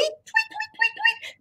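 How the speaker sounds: very high soft tweeting; bird tweets. Shown as a demonstration. Teacher showing kids bird tweets, very high. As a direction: chirp like a small songbird. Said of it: "birds that tweet".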